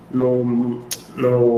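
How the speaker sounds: ordinary talk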